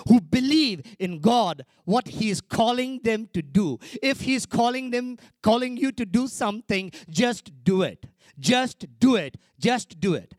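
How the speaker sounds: repeated exhortation shouted; fiery preaching. Real, not acted. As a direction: drive it home to the congregation. Preaching at full force.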